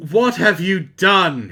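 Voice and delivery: loud, slow hammed-up accusation; loud slow mock-stern wizard; mock angry Dumbledore. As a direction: thunder it out slowly. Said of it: "walked in angrily".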